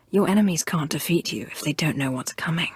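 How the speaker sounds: quick hushed whisper; hissing dark advice; fast conspiratorial whisper. Acted. Sith shares a dark secret.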